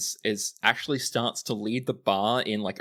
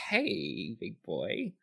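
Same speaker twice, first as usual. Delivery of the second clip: fawning flirt; 5 dB quieter, much slower than usual. Acted. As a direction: play an admirer fawning over someone. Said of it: "fawning over you like".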